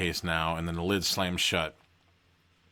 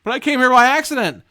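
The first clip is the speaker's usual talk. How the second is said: very high, loud panicked protest; frantic high-pitched pleading; protesting cry; 11 dB louder, faster than usual. A narrator's reading. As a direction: wail it out in alarm. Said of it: "you cry".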